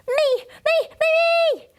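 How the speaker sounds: high pleading me me me; eager shouting. Acted. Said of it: "I shouted".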